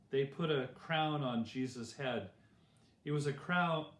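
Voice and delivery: plain reading voice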